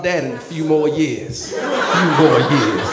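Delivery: slow, measured mock menace; lightly voiced little tough guy; sizing-up young son. Seven-year-old sizing up his dad, slow mock threat. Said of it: "He sizing me up all the time".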